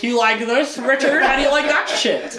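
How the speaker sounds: loud, high-pitched showing off; hammed-up boast, voice pushed up; mock taunting. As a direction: crow it as a playful challenge. Wizard showing off his new beard.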